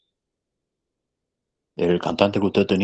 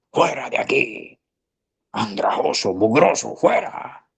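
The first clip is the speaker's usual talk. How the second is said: scornful ushers; 5 semitones higher, slower than usual. Retold or imitated. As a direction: shoo a ragged boy out with scorn. Ushers throw poor boy out.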